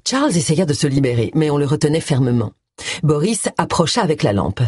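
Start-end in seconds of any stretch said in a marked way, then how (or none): none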